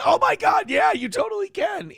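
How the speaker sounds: same thrilled squeal as before; mock gushing excitement. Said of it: "It's the same".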